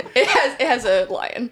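ordinary delivery

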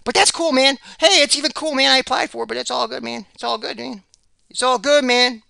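high, loud sarcastic "it's all good, man"; mock-cheerful shrug of a line. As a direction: pretend to be fine with it, dripping sarcasm. Bitter joke about the job he applied for.

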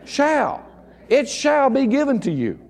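"Shall" said high and firm, then "shall" stressed again. Shown as a demonstration. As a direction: hammer the word "Shall". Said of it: "Just real plain there".

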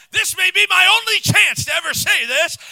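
loud, high, eager outburst; excited shout. Retold or imitated. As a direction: burst out with glee. Paul seizing his chance.

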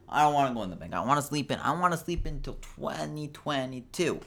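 put-on high, childish complaint; hammed-up reluctance, pitch climbing; mock whining. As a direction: whine like a kid refusing to get up. Acts out own reluctance about bank.

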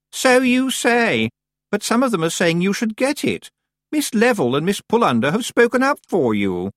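high-pitched, suspicious challenge; haughty Anagramma, upset. A narrator's reading. Anagramma doubting Tiffany's denial, haughty and upset.

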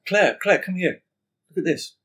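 a high, loud call to Claire that eases off; calling out excitedly. Retold or imitated. The client calling his wife Claire over to look, excited. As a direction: call her over, thrilled.

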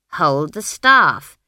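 loud, slow, insistent command; insistent order. A narrator's reading. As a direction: repeat the order slowly and forcefully. Granny repeats her order insistently.

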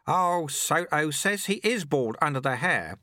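put-on high-pitched old sweeper; old sweeper-monk. A narrator's reading.

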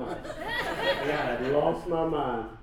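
slow, laughing through the words. Real, not acted. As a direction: chuckle it out slowly.

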